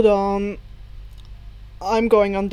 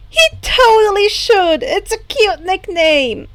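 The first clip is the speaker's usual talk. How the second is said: put-on lightly giggly girl, higher; bubbly, teasing and lifted; 8 semitones higher, 5 dB louder than usual. Hagakure teasing Shoto about the nickname, light giggly lift. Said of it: "Hagakure giggled".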